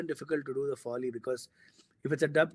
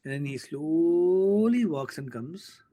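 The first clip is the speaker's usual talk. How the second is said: louder, drawn-out telling of the slow approach; slow suspense build; 4 semitones higher, 6 dB louder, slower than usual. Retold or imitated. Recounting the critic's return, slow and dramatic. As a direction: stretch it out for maximum suspense.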